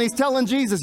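normal talking voice

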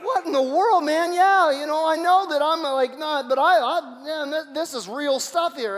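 high, loud, flustered protest; mock exasperation. The preacher voicing Abimelech's outrage, high and flustered. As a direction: play the fed-up king, stumbling for words. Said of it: "that's what I'd be saying".

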